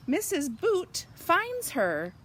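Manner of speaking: ordinary delivery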